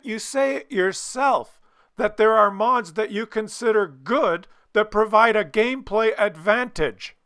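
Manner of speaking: high, insistent, incredulous rebuke; exasperated scolding. Real, not acted. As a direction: pitch it up and press each phrase. Scolding the developers over contradiction.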